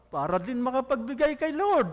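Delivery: ordinary talk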